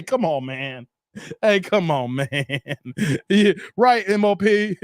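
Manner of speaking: usual speaking voice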